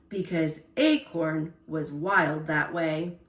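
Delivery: usual speaking voice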